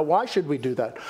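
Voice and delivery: normal talking voice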